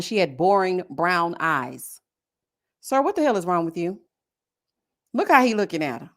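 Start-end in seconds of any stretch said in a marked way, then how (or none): none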